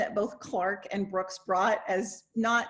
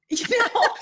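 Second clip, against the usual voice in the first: laughing through it, pitch jumping up; giggling, can't hold it in; 12 semitones higher, 6 dB louder than usual. Real, not acted. Host laughing at her own joke about old Hamlets.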